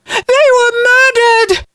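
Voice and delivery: very high-pitched, slow accusation; outraged cry. A narrator's reading. Sister accuses her brother from the well, outraged cry. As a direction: cry it out in horrified protest. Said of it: "cried Allison".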